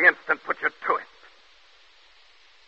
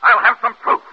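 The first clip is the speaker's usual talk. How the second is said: higher, louder insistent shouting; insist, voice rising in rage; fevered demand for proof; angry demand; 4 semitones higher, 4 dB louder than usual. Acted. Othello demands proof, furious.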